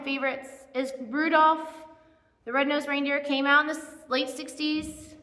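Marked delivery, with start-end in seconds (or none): none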